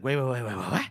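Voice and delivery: mock cutting-in, rapid repeated waits; lightly acted startled interruption; interrupting. Calder interrupts Crawl in disbelief, interrupting. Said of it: "he interrupts him".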